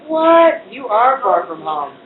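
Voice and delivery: quoting a friend's amazed reply; surprised reaction. Retold or imitated. Friend reacting to the Tulsa distance, loud surprise. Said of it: "And he's like".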